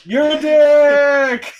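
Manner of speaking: high, loud, drawn-out shout; outraged captive. Acted. Captive insults the rescuers who mocked his plea, outraged high shout. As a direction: yell the insult slowly in a high put-on voice.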